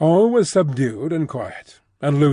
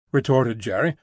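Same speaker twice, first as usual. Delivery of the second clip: usual speaking voice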